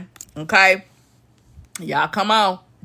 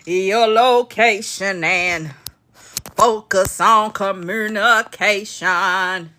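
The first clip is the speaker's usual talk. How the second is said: slow mimicked quote; much slower than usual. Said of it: "he was like".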